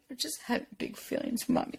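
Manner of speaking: put-on small, soft, breathy child voice; mock tearful son. Son explaining his feelings in a hushed tearful voice. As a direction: whisper it like a weepy little boy. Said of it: "and he's just crying".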